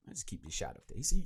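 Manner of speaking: usual speaking voice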